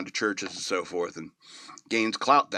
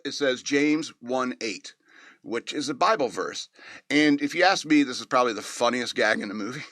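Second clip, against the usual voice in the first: ordinary delivery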